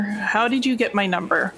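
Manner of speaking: ordinary talk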